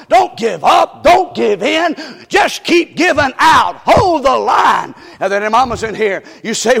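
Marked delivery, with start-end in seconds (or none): 0.1-4.9 loud, high preacher's cry; shouted exhortation. Acted. Preacher urging fathers not to quit, loud shout. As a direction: push them not to quit.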